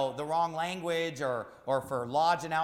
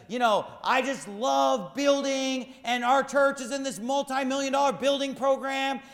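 mocking pastor quote; 8 semitones higher, 5 dB louder than usual. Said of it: "this pastor said".

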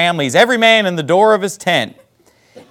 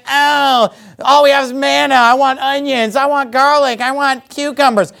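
whining complaint; 8 semitones higher than usual. Acted. Preacher mimics Israelites sick of manna, mock whining. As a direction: whine it like a fussy child, exaggerated. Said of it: "Crying and whining and complaining".

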